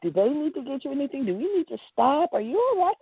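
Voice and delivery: lightly voiced Betty fussing tenderly; sweet concern. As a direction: fret kindly over the sick girl. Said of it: "she was so sweet".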